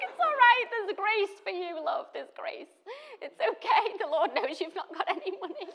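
high, loud comforting words broken by laughter; laughing reassurance. Retold or imitated. Mother retelling how she comforted her daughter, laughing high and loud. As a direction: soothe a child while you can't stop laughing.

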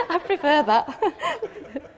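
said through a slow chuckle; laughing. Real, not acted. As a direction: laugh while you say it, drawn out. Laughs at the new phrase.

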